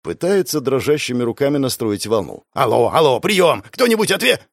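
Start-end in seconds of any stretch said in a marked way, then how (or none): 2.5-4.4 rising to a high, urgent plea; panicked radio call. Acted. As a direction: call out desperately, pitch climbing. (отчаянно взывать по рации)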